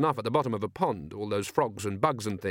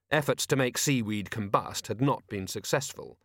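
usual speaking voice

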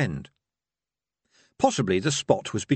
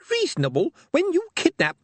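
outraged minstrel; 4 dB louder than usual. A narrator's reading.

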